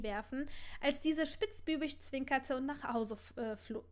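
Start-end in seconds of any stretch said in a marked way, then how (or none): none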